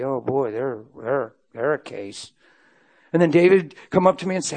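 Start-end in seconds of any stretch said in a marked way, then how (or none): 0.0-2.3 muttered verdict. Acted. Imagined verdict on a counselee.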